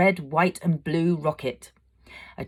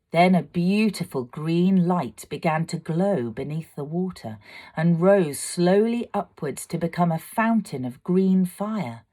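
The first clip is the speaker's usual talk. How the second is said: ordinary delivery, nothing marked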